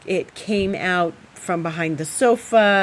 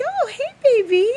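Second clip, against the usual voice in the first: sweet cat greeting; 5 dB louder than usual. Real, not acted. Greeting her cat in a high cooing voice. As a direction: coo a hello to your pet.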